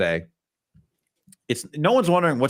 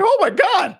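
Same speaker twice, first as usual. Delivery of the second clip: acted high, loud couch-fan outburst; hammed-up astonished squeal; excited fan reaction; 7 dB louder, slower than usual. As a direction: mimic a thrilled viewer yelling at the TV. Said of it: "on my couch, I'm going like".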